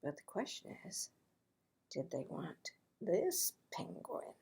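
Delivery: unvoiced whispered aside. A narrator's reading. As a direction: lean in and whisper the question.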